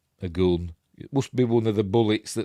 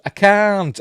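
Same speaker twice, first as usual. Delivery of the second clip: high, louder choked refusal; anguished refusal; 11 semitones higher, 6 dB louder than usual. Retold or imitated. Daughter refusing to shoot her father, high and anguished. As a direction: cry out that you can't, high. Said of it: "She goes".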